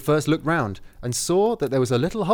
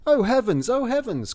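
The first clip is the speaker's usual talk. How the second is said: high-pitched storybook astonishment; astonished cry; 7 semitones higher than usual. A narrator's reading. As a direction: cry out in wonder. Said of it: "cried out with astonishment".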